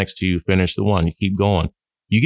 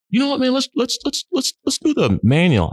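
much higher eager buddy voice; imitating John eager. Friend calling to pitch manual practice, high eager imitation. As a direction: play the excited friend on the phone. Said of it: "John calls up".

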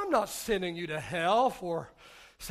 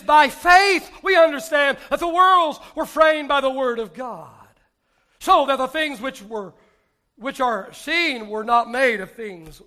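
preaching scripture; 6 semitones higher, 12 dB louder than usual. Acted. Reading scripture forcefully.

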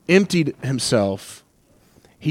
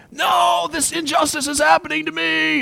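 mock scream; 13 semitones higher than usual. Preacher acts what Jesus didn't do, mock screaming. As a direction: cry out in exaggerated outrage at injustice. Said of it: "he didn't scream".

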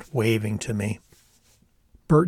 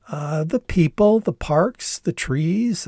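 high bouncy listing; singsong contestant mimicry; 6 semitones higher, slower than usual. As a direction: list it like a TV contestant. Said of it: "mimicking his style and cadence".

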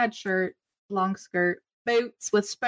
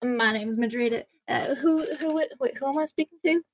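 higher, scattered and eager; flustered Madril voice; 5 semitones higher than usual. Acted. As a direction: trip over the question sweetly. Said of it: "She goes".

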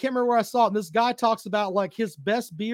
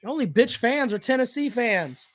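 ordinary talk

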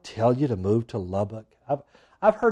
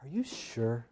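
soft, insinuating whisper; hushed mock whisper of temptation; whispering tempter; 3 semitones lower, 12 dB quieter than usual. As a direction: whisper doubt into her ear, acting the tempter. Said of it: "just whispering to her".

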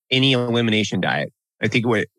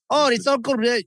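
put-on high-pitched, botched German accent; bad German accent; 14 semitones higher than usual. Host imitating Freud to co-hosts, bad German accent. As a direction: attempt a German accent and miss. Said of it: "I can't do a German accent".

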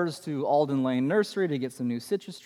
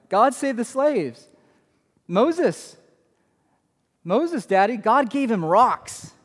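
put-on higher, slow little-girl voice, lightly done; imitating his daughter; 4 semitones higher, slower than usual. Dad imitating his preschooler in the minivan, higher and slow. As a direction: play a four-year-old proudly announcing. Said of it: "my daughter says".

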